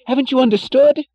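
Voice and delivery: high, loud, bewildered gasp; gasping alarm. A narrator's reading. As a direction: gasp it out in shock. Jim frantic, bewildered.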